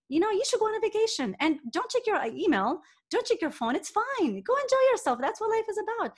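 high, playful, teasing delivery; a mock-carefree, sing-song nudge to a coworker; playful, funny voice. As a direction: talk way up high, lightly joking. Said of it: "like, funny".

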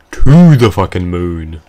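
yelled curse at the boss; shouting. Real, not acted. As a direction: shout it out, furious. Cursing at boss.

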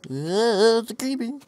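startled. Real, not acted. Sudden creeper appears.

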